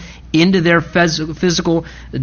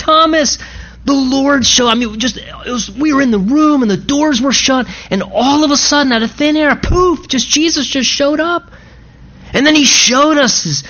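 high, eager tumbling account; excited disciples; 7 semitones higher than usual. Acted. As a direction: pour out the story to a friend. Disciples recounting to Thomas.

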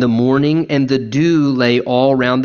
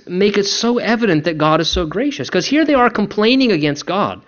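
normal talking voice

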